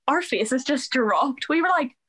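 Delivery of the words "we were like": usual speaking voice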